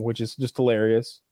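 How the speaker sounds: ordinary talk, nothing marked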